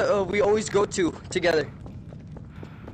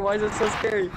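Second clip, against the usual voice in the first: normal talking voice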